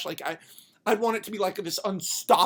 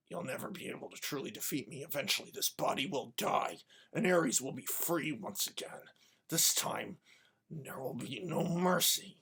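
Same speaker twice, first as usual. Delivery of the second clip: very soft, low menace; acted weakened, sinister murmur; hushed villain threat; 5 semitones lower, 11 dB quieter than usual. Fallen villain's quiet threat.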